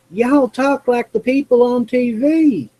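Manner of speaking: put-on high-pitched Southern lady accent. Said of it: "as a Southern lady when I was in high school said".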